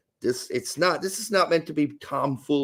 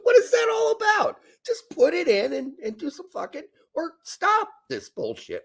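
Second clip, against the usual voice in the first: high-pitched, incredulous whine; exasperated rant. Real, not acted. Host telling performers to stop clowning, high exasperated rant.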